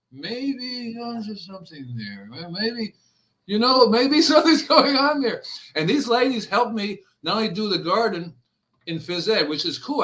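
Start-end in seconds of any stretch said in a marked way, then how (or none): 0.1-2.9 softer, slow, drawn-out wondering voice; slow musing. Retold or imitated. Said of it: "and then he was going". 3.5-5.4 louder and much higher, breaking into a laugh; laughing realization. Retold or imitated. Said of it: "and then he was going".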